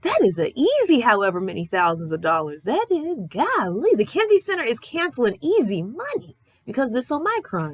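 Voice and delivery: squeaky mock outrage at the cancellation; incredulous retort. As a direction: sound aghast at the news. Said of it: "And I was like".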